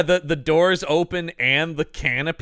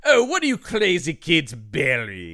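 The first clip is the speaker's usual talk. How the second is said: slow, cheery put-on voice of a playful neighbour; hammed-up jolly grown-up humoring kids; cheery old man. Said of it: "Roland going".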